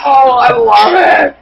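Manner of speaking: slow, high, loud delighted cry. Real, not acted. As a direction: savor it out loud with glee. Delighted reaction to video.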